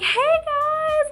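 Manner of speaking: high, loud imitation of an excited greeting; super nice, excited. Singer greeting fans as they walk in, super nice and excited. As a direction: gush it like a thrilled star's hello. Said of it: "like, super nice, excited".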